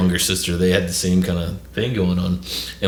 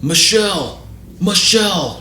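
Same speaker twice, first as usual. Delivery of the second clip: higher-pitched imitated search call; 11 semitones higher, 3 dB louder, slower than usual. Firefighters searching for the aunt, imitated calling out. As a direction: call the name through the house.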